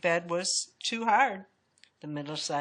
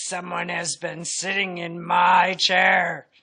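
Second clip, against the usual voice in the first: loud, slow papa bear boom; great big voice; 8 dB louder, slower than usual. A narrator's reading. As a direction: make it big and weighty, like a huge bear. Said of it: "said Papa Bear in his great big voice".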